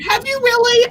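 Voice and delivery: high, loud surprised question. Real, not acted. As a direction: gasp it out in disbelief. Reacting to co-host's news.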